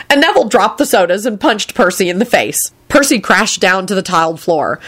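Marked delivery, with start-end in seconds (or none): none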